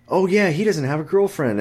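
high, eager gossipy mimic of a friend; mocking chatty friend. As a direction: ham up the oblivious friend oversharing. Mocking friends blabbing to girls.

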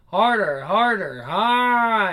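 loud moans between urgent demands; acted moaning. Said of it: "the moans get out of hand".

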